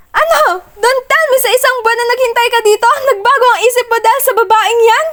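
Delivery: high, fast, loud demand; angry shout. Acted. Heroine confronting Achilles about the woman, angry shout. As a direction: fire it at him in disbelief.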